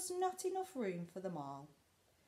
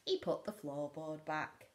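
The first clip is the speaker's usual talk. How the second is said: ordinary delivery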